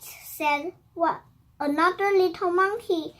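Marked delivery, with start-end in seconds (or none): none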